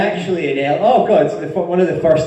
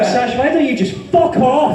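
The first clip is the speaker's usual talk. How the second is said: playful higher shout of fuck off; mock telling off; 8 semitones higher than usual. Guest jokingly snapping back at the host, mock shout. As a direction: fire it back as a joke.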